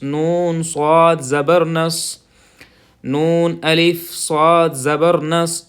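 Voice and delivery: نون صاد زبر نس spelt slowly, heavy صاد; spelling out saad. Shown as a demonstration. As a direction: spell the letters, stress صاد. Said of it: "صاد کی آواز نکالیں گے". (حروف الگ الگ، صاد پر زور)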